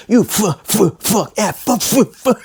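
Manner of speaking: high, sputtering string of cut-off curses; mock cussing tirade. Host mimics the agent's F-bombs, sputtering high mock rage. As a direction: imitate a cussing caller, breaking into laughter. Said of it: "edit out all the F-bombs".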